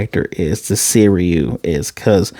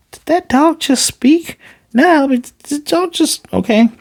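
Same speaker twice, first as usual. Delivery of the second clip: very high, shocked squeal; high-pitched mock disbelief at a talking dog; stunned disbelief. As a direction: squeal it out like you can't believe your ears.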